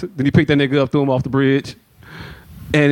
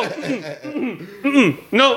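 high hummed no, laughing; mock refusal; 5 semitones higher, 5 dB quieter, much slower than usual. Toddler refusing nyquil, high laughing hums.

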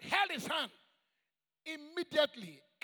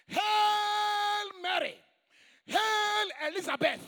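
calling out a greeting; 6 semitones higher, 5 dB louder than usual. Acted. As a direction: proclaim it loud and high, stretching the greeting. Preacher calls out biblical greeting.

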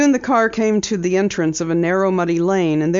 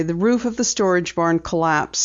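plain reading voice

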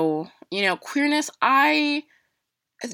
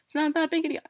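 higher, dismissive imitation of mom; mocking mom; 10 semitones higher, faster than usual. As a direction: brush it off like an impatient mother would. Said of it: "She would just tell me to get over it".

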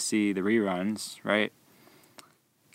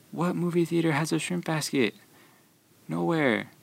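raised, mock-baffled retort; incredulous, higher; 6 semitones higher than usual. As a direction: voice the question aloud in stunned disbelief. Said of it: "I was like".

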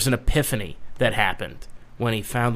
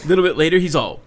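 ordinary talk

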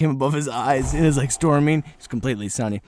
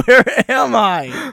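bewildered; 10 semitones higher, 9 dB louder, much slower than usual. Real, not acted. Mock bewilderment.

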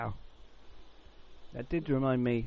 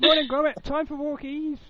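high, loud Wallace impression; cheerful wake-up call, pitched up; talking alarm clock; 12 semitones higher, 5 dB louder than usual. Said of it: "Woke me up every day".

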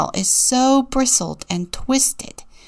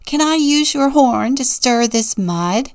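raised, higher call across to him; a friendly called request, pitched up; 5 semitones higher, 6 dB louder than usual. A narrator's reading. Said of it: "she called".

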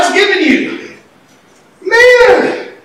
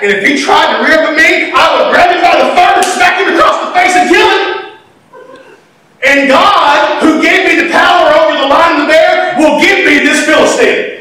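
fiery boast; 5 semitones higher than usual. Acted. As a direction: build it into a shout. David boasting, preacher building.

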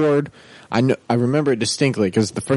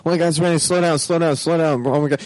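higher, frantic mock panic over the rain; mom panicking at rain; 5 semitones higher, faster than usual. Mom in the passenger seat panicking at her son driving in rain, higher frantic imitation. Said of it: "She's like".

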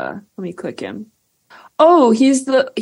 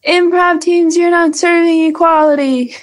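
sassy call-out; 9 semitones higher than usual. Pretend. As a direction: snap at them with diva sass.

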